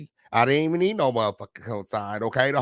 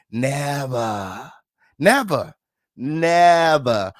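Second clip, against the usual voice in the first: each never punched harder and louder; stacked never growing louder; emphatic repetition; 5 dB louder, much slower than usual. Acted. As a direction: hammer never, never, never.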